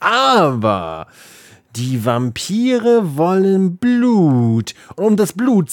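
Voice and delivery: slow ominous narration; dramatic storyteller. Pretend. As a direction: draw it out like a spooky tale. (langsam, unheilvoll erzählt)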